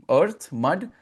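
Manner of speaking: usual speaking voice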